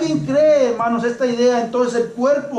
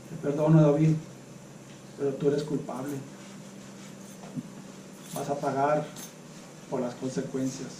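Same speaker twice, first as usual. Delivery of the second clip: cold unforgiving pardon; 8 semitones lower, 12 dB quieter than usual. Acted. Acted cold forgiveness to David.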